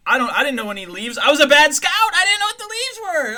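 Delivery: rising into frantic panic; panicked high rant. Real, not acted. Scout recalling leaves, voice climbing in panic. Retelling camp panic, voice rises.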